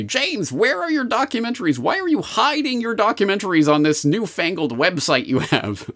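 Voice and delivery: high mock-whiny complaining viewer. Viewer complaining to James in a high whiny voice. Reading viewer email mockingly.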